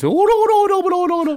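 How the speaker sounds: very high, loud mock Brummie squawk; hammed-up drunk Brummie accent; drunk Brummie wife. Husband imitating his drunk wife, mock Brummie accent. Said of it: "my wife is so brummy ... When she gets drunk".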